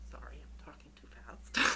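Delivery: whispered apology aside; whispering. Real, not acted. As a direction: drop to a quick embarrassed whisper. Apologetic aside mid-speech.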